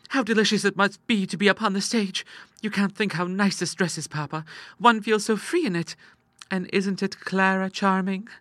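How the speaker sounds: put-on high-pitched, gushing young girl; excited sister Ida. Girl showing off her dress to papa, high excited gushing. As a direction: enthuse in a high girlish voice.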